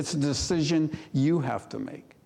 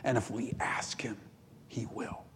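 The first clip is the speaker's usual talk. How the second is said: whisper; 3 semitones lower, 9 dB quieter than usual. Real, not acted. Intimate close to a point.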